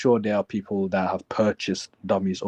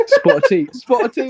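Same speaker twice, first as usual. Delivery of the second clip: much higher and louder, a squealing mock British echo; 10 dB louder than usual. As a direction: shriek it back in a posh mocking voice. Mocking friend's fake British phrase.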